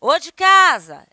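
loud slow call into a house; calling at the door. Shown as a demonstration. Teacher demos calling at a door, loud and slow. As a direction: call out to whoever's home. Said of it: "é uma interjeição".

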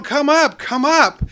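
high, loud call from the mountaintop; yelling down. Retold or imitated. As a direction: shout it down to the man below. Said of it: "he yells down".

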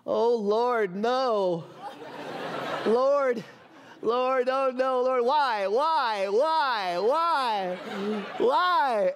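hammed-up repeated lament; crying out to God. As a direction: imitate a sick child pleading with heaven. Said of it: "he's crying out to God".

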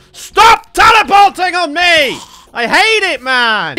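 loud, high frustrated shout; angry yell. Real, not acted. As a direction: yell it slowly at the enemy. Rage at teleporting enemies.

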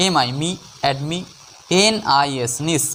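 ordinary talk, nothing marked

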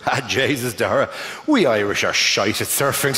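low, heavy thick Irish accent, lightly voiced. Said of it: "in the thickest accent".

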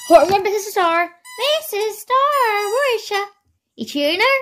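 high, sing-song voice for the dog. Real, not acted. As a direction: coo at the puppy up high. High pet-talk to the dog.

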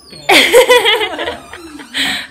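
loud bright burst of laughter; real laugh. Real, not acted. Laughing after filming ends.

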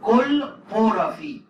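كل بورافي loud, high and slowed right down; كل stressed, بورافي drawn out and falling; model pronunciation. Shown as a demonstration. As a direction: drill كل بورافي for the class, loud and slow. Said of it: "يبقى هتقول ايه؟".

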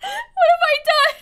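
high, wailing whine; half-laughing high lament at being trapped; mock despair. Real, not acted.

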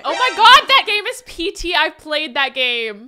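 loud, high gush of delight; thrilled excitement. Real, not acted. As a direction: gush over a favourite game. Recognizes game on streamer.

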